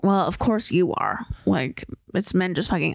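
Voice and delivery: usual speaking voice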